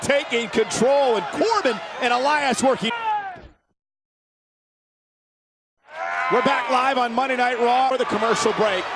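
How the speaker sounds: excited live ring call; wrestling commentator. Real, not acted. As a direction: call the match as it happens. Live play-by-play of tag match.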